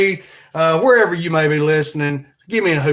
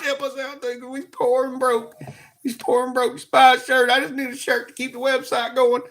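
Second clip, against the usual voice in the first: high-pitched comic pleading; mock begging; 8 semitones higher, 3 dB quieter than usual. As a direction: beg in a silly high voice. Comic begging to buy merch.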